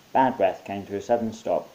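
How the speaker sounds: usual speaking voice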